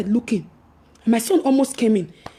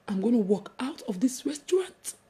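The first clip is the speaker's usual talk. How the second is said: whispered; 5 semitones lower, 6 dB quieter than usual. Retold or imitated. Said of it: "I said".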